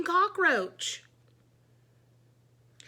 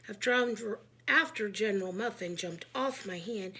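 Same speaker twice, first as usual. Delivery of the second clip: ordinary delivery, nothing marked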